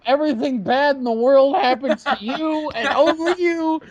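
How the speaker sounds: theatrical high-pitched condemnation; mock solemn curse. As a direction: pronounce a hammed-up curse on a friend.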